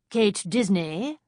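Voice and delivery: put-on slow, thick French-accented demand; a French woman calling out a name. A narrator's reading. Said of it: "she demanded".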